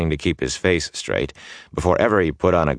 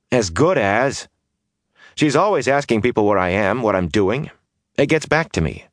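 put-on higher, eager young rogue voice; brash young man; 5 semitones higher than usual. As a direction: restless youth pleading to leave. Mat's voice again.

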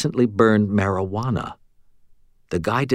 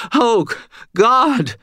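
much higher, louder terrified wail; frightened moan; 4 dB louder than usual. A narrator's reading. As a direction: cashier begging in terror. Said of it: "Stan moaned".

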